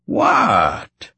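high-pitched, mock-innocent protest; dramatic protest. A narrator's reading. Husband answering his wife's warning, dramatically protesting. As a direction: protest theatrically, pitched right up. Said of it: "he protested dramatically".

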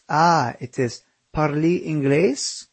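put-on slow, Italian-accented "parli inglese", rolling the vowels; a southern Italian man asking "parli inglese". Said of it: "I repeated the phrase in my best Italian accent".

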